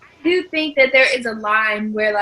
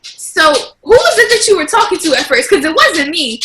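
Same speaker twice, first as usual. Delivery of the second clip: loud, higher-pitched motherly challenge; mom's indignant reprimand; 6 semitones higher, 6 dB louder than usual. Acted. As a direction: imitate a mother pulling rank. Imitates mom reasserting herself as mother.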